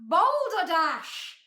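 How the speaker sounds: loud, drawn-out interruption; booming shout. A narrator's reading. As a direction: boom it out slowly. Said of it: "shouted a booming voice".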